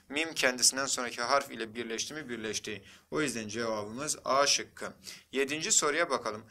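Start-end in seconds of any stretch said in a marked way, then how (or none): none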